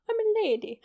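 "I'm a lady" lifted high in a posh British accent; prim posh British lady announcing herself, pitch up. Shown as a demonstration. Vlogger showing off her posh British lady voice, high posh British accent. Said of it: "A posh British lady".

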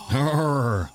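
mummy groan. Pretend. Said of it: "You sound like a mummy".

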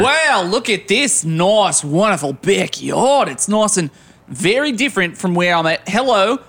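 put-on lightly done Australian accent; easygoing Aussie surfer voice. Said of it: "by your accent that you're from Australia".

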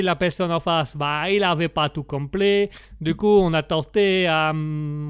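hesitant mock Italian accent; sing-song Italian accent, feeling for the words. Italian guest dodges why he left, hedging in Italian accent. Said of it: "comme on l'entend à mon accent".

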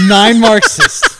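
loud, high burst of laughter; cracking up while repeating the phrase; laughing. Real, not acted.

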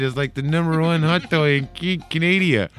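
broad put-on Canadian accent; mock Canadian accent. Host plays a Canadian toy podcaster, mock Canadian accent. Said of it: "what if there was a Canadian toy podcast".

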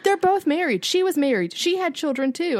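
high, insistent protest; indignant. Real, not acted. Friend arguing both cheaters were married, indignant. Heated point about the affair.

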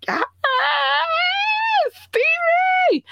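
high squealing cheer; excited cheer. Retold or imitated. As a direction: squeal his name with joy. Excited cheer after happy juice.

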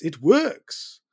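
hammed-up French accent on "works". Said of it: "That's not a French accent".

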